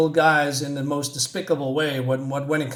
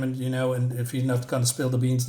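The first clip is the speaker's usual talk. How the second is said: ordinary talk, nothing marked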